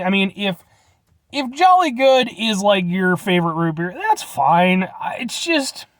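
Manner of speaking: ordinary delivery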